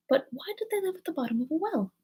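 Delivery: high, rising wonder; high puzzled question. A narrator's reading. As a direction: ask it high and bewildered. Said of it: "repeated her question".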